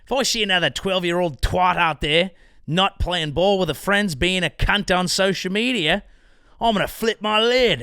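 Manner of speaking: hammed-up Aussie prime minister ranting; Australian accent. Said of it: "He said".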